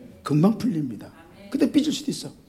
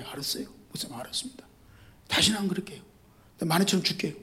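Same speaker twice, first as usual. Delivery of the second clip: meek apology; 11 dB quieter, faster than usual. Retold or imitated.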